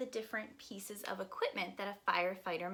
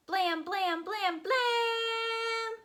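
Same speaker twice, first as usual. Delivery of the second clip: higher and higher honking horn blasts; mock air horn; 7 dB louder, much slower than usual. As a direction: sound the horn like a toy truck. Fire truck air horn sound.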